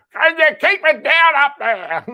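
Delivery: high, loud nagging complaint; annoyed neighbor. Retold or imitated. Goat imitates annoyed neighbor.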